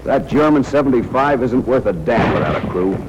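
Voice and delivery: ordinary talk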